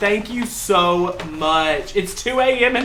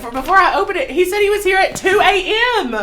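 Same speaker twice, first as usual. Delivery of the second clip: high, loud, laughing; thrilled squeal at the gift; delighted shriek; giddy excitement; 14 semitones higher, 9 dB louder than usual. Real, not acted. Opening a surprise package.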